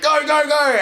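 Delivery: high, loud barked order; mock police shout. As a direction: yell it like a cop storming in. Swat police storming out.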